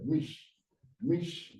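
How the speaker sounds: "mish" as one joined syllable; closed syllable. Shown as a demonstration. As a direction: sound out "mish", ending on the sh. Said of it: "they are joined together".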